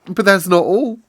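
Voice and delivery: solemn excitement; excited. Acted. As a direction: tease the bigger news. Mary excited about the garden.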